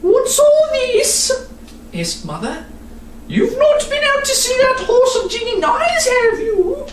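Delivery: mother demanding answers; stern mother. Acted. Mother questions the children.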